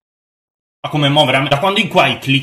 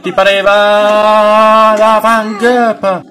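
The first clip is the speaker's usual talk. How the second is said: long, high wail growing louder; drawn-out panic; 7 semitones higher, 7 dB louder, much slower than usual. Real, not acted. Player spotted by enemies mid-game, wailing in panic. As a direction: stretch each phrase into a high panicked cry.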